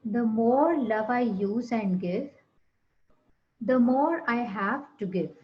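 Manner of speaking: calm, spacious meditation read; guided meditation. Acted. Leads affirmations over music.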